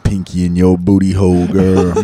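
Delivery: very low seductive crooner. Shown as a demonstration.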